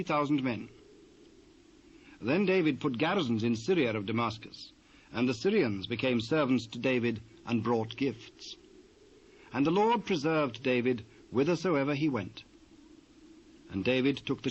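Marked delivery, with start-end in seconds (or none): none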